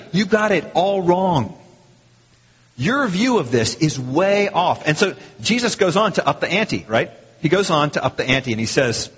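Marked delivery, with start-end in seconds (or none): 0.1-4.8 slow, weighty rebuke; very emphatic. Acted. Preacher voicing Jesus correcting the questioner, very emphatic. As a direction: spell it out slowly, leaning on each word. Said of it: "Very emphatically".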